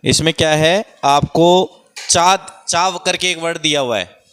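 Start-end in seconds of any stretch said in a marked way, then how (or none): 1.0-2.4 चा drawn out slowly and loud; stretched syllable. Shown as a demonstration. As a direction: hold the sound चा so children hear it. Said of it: "teacher stretches the syllable". (चा को खींचकर बोलना)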